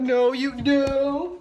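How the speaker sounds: loud firm telling-off. Real, not acted. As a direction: stop the pet sharply. Stopping cat following her.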